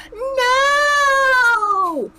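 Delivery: very high loud screaming no; high-pitched scream. A narrator's reading. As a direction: scream until the glass cracks. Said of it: "scream so high-pitched".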